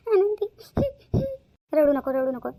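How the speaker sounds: sobbing out the friend's name through tears; crying. Acted. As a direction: cry while begging her to stop. (रडत रडत मैत्रिणीला हाक)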